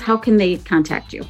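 ordinary delivery

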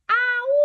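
long high-pitched loud howl; werewolf howl. Shown as a demonstration. Storyteller howling for young listeners, werewolf howl. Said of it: "howls the werewolf".

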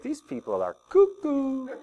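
a hint of a scoffing bystander; skeptical thought. Pastor voicing a doubting newcomer: light mock scoff. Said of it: "you know, you think".

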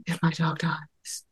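whispering the horror to yourself; hushed dread. Retold or imitated. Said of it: "you immediately go to".

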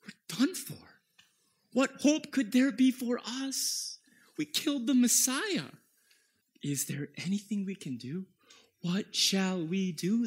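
high, pleading cries of panic; desperate crowd. Acted. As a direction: beg like people who just realised their guilt. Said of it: "they say".

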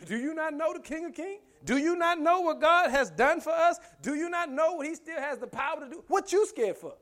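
high, loud rhetorical challenge; full-force preaching. Acted. Preacher rebukes someone scared of trouble, full-force preaching. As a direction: drive each question home, pitched high.

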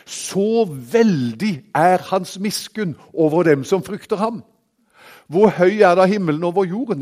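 ringing sermon delivery; full-force preaching. Acted. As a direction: proclaim it to the whole congregation. Preaching on grace with scripture.

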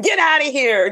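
high laughing mock shout; laughing mock yell. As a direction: playfully yell while laughing. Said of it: "I said".

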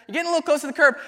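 instructor's warning turns into a near-yell. Retold or imitated. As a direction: raise the alarm as the car nears the curb. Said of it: "And she goes".

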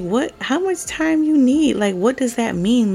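high, loud incredulous questioning; exasperated disbelief. Real, not acted. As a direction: demand an answer, exasperated and high-pitched. Mock reaction to husband's excuse.

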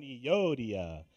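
low, drawn-out Euodia with a hammed-up foreign accent; a mock foreign accent, rehearsing the name at a desk; exaggerated mock accent. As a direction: ham up a Middle Eastern accent on Euodia, the last one loud. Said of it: "He's just looking at a screen going".

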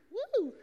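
high, soft ghostly hoot; little falsetto woo; playful ghost woo. Retold or imitated. Jesus joking he is see-through, playful high woo.

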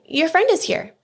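high, carrying mother's call; calling upstairs. A narrator's reading. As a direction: announce a visitor up the stairs. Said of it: "Mother called up to Yunhai".

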